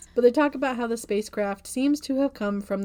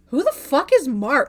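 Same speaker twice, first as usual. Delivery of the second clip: mock outraged; 5 semitones higher, 8 dB louder than usual. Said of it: "Floyd goes".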